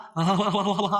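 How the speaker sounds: imitated run of open "ha-ha" laughter; Reethu's laugh. Reviewer imitates a contestant watching the story reel, mock laugh. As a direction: mimic her laughing at the clip. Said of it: "అని రీతు నవ్విద్ది".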